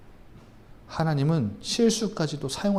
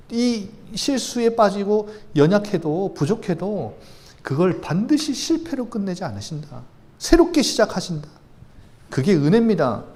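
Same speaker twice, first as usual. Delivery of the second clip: louder, rising preacher's voice, driving the point home; fervent preaching; 5 semitones higher, 6 dB louder than usual. Acted. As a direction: preach it out with full conviction. Preaching God's faithfulness.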